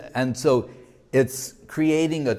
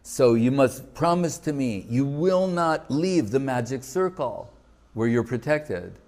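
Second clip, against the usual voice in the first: normal talking voice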